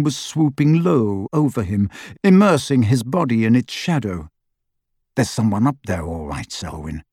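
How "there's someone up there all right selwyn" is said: low voice, dropping soft at the end; cool second man. A narrator's reading. Second Death Eater reporting to partner Selwyn, low voice. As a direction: confirm it low and curt. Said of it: "said the second man sharply".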